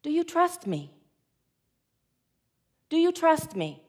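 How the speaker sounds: soft, hushed question; gentle asking. Acted. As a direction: voice God asking quietly and patiently. God's gentle question after the panic.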